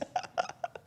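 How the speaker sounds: airy silent laugh; real helpless laugh; breathy laugh. Real, not acted. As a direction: laugh almost without voice. Laughing at the chat.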